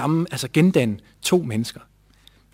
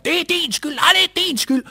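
high mock blame shouting; retold yelling match; 13 semitones higher than usual. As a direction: trade blame in a heated quarrel. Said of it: "jeg råber op". (far og søn råber skænderi, gengivet)

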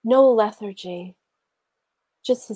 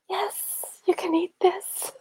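high, strained acted yes; tight, high-pitched and hesitant; nervous permission; 11 semitones higher, slower than usual. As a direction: force out permission despite the fear. Acts out fearful permission to son.

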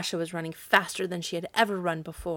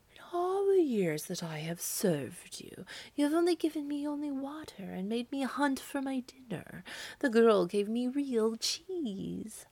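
aggrieved cat; 5 semitones higher, 4 dB quieter than usual. A narrator's reading. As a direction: complain softly, stretching the words. Cat answers witch back resentfully.

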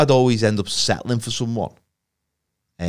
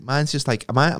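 ordinary talk

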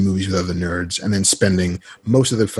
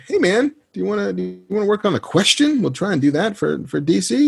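high-pitched casual friend; Kevin impression; 11 semitones higher than usual. Friend pitching comic work, imitated in higher voice. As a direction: imitate a friend floating yet another project. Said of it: "The way you do his".

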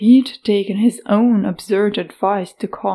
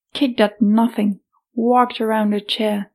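usual speaking voice

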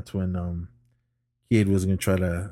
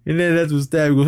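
ordinary delivery

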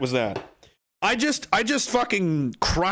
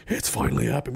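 usual speaking voice

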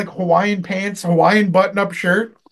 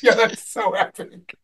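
laughing praise; 5 semitones higher, slower than usual. Retold or imitated. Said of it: "I was like".

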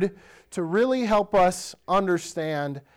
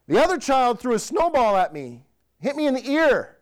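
lightly voiced whiny kid, a bit higher; child's complaint; 7 semitones higher, 3 dB louder than usual. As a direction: mimic a child tattling to dad. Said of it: "My one child says".

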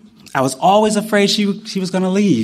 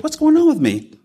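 bewildered self-question; 11 semitones higher than usual. Retold or imitated. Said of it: "and I'm like".

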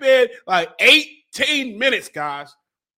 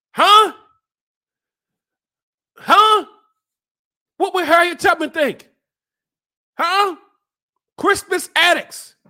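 high, strained, raised demands; challenging harangue; 6 semitones higher than usual. Acted. Host haranguing viewers to endure, challenging high yell. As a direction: throw it out as a heated challenge.

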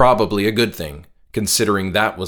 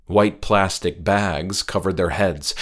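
usual speaking voice

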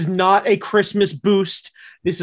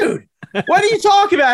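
mock protest; 11 semitones higher, faster than usual. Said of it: "And I'm like".

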